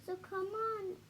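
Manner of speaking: high, urgent, pleading elephant voice; pleading call. Acted. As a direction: beg them not to give up. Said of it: "he managed to call to the mayor".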